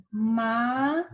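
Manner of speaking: MALA split into two long syllables; separated syllables. Shown as a demonstration. Teacher showing a word she separates, mala in two drawn-out syllables. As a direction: say mala slowly, pausing between ma and la. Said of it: "Essa é uma que eu separo".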